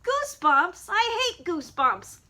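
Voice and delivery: high put-on pup voice, slowing as it complains; high, drawn-out mock complaint; voiced toy dog. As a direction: play a stuffed dog whining about homework. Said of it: "I make him say".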